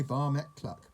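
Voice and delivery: ordinary delivery, nothing marked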